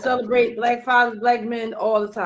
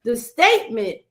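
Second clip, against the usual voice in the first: normal talking voice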